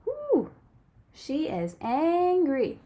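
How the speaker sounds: expressive picture-book read-aloud; reading to children. A narrator's reading. Teacher reading a storybook to her class, lively and expressive.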